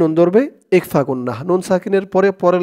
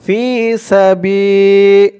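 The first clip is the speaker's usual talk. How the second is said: slow chanted tajweed reading; Quran recitation; 4 semitones higher, 5 dB louder, much slower than usual. Acted. Teacher reciting the verse for students to follow. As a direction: draw out فالذين هاجروا melodically.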